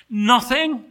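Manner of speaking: NOTHING pitched high and loud; incredulous echo. Acted. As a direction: blurt "Nothing" in disbelief. Angel reacts in disbelief.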